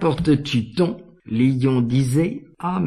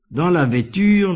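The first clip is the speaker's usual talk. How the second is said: ordinary delivery, nothing marked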